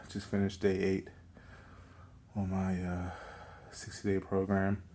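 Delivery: slightly winded after a workout; out of breath. Real, not acted. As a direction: talk a little short of breath. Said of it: "I'm a little winded".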